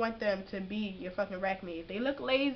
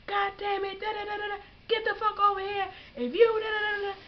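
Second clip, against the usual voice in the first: higher, louder mock drill instructor bark; drill instructor yelling; 11 semitones higher, 4 dB louder than usual. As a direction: yell at recruits to rattle them. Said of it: "they just yelling at you".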